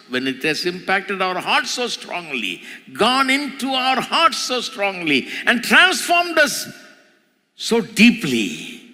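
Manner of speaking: climbing high to a pulpit climax; fervent, mounting preacher's cry; impassioned preaching. Acted. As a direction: build the sermon phrase by phrase to a high peak.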